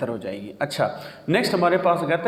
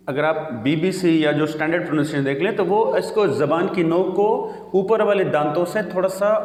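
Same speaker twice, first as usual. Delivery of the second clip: usual speaking voice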